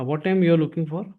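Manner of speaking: usual speaking voice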